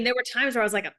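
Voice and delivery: ordinary talk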